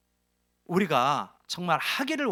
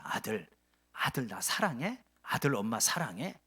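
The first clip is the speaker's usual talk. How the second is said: mother asking; 7 semitones lower, 6 dB quieter than usual. Acted. Mother asks her blunt son.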